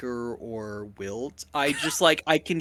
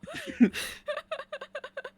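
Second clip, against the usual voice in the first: high giggle; 6 dB quieter than usual. Real, not acted. Laughing at friend's joke.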